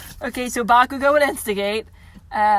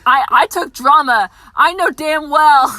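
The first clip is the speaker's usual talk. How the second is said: louder, slow, drawn-out sass; loud, slow, mock-confident boast; sassy retort; 4 semitones higher, 10 dB louder, slower than usual. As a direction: lay it on like a smug drama kid. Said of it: "'cause I'm like".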